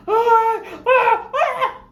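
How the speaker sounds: high loud laughing wail; shrieking mock panic with a laugh; mock freakout wail. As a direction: imitate a grown man losing it over a needle. Mimics his freakout.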